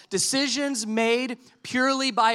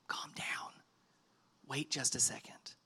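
whisper; 7 semitones lower, 14 dB quieter than usual. Acted. Pastor speaking to overwhelmed listeners.

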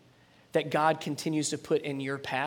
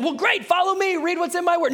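higher, loud, insistent call; God calling out; 13 semitones higher, 7 dB louder than usual. Acted. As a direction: shout the invitation. God voiced answering.

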